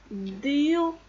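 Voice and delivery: "Dio" as one drawn-out syllable; syllabe modèle. Shown as a demonstration. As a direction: model "Dio" for learners. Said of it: "ça se prononce". (« Dio » articulé en une syllabe)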